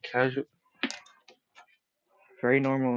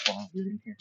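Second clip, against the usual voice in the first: voice cracking; 8 semitones higher, 8 dB quieter, much faster than usual. Real, not acted. Streamer sending an interrupter back to the living room, voice cracking. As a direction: voice jumps up and cracks. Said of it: "My voice cracked once again".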